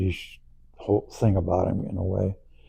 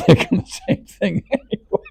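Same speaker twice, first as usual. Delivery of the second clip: laughing; 3 dB louder than usual. Real, not acted. Group cracking up, laughing.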